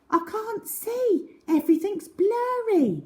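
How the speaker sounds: lost without her glasses, worried; girl straining to see; Cherry, worried girl. A narrator's reading. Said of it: "said Cherry".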